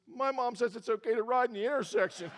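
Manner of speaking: put-on high, pouty little-kid voice; pouting boy. Buddy tells about his own mom, high pouting whine. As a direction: whine it through a pout. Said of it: "starts pouting like this".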